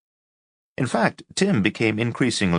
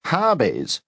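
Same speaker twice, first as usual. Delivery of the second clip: scoffing, pitched high and falling; disdainful scoff, much higher; contemptuous snort; 9 semitones higher than usual. A narrator's reading. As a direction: scoff at the word Hobbies. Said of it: "snort contemptuously".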